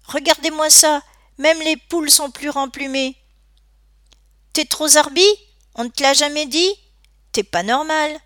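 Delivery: high, loud bullying ridicule; mocking jeer. A narrator's reading. Gang ridiculing their victim to his face, mocking.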